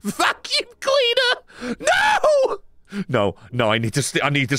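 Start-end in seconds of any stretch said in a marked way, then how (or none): 0.0-1.8 high, slow excited shriek; shrieking jackpot winner. Real, not acted. First squeal at the vacuum. 1.8-2.6 shocked cry. Real, not acted.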